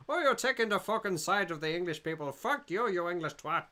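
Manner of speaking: hammed-up Irish telling-off; Irish accent. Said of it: "uh, Irish accent".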